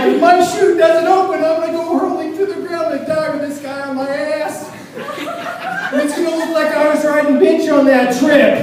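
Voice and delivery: high, loud comic panic; freaking out. Acted. Skydiver strapped to another man, comic freak-out. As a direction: rant in hammed-up panic. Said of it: "I'm freaking out".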